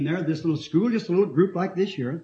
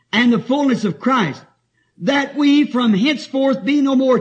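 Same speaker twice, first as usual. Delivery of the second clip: scripture reading; 4 semitones higher, 4 dB louder than usual. Acted. Reading scripture aloud in sermon.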